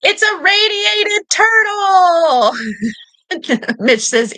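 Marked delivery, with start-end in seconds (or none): 0.0-2.5 high, slow, shouted reveal; game show reveal shout; excited announcement. Real, not acted. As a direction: cry out the answer like a big win. Host announces answer excitedly.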